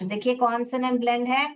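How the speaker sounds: ordinary talk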